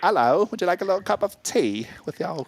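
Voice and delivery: a high, put-on British lilt offering tea with a Texas y'all; mock British accent. Host offering a caller tea in mock British accent. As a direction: play a prim English host pouring tea, hammed up. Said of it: "in that accent?".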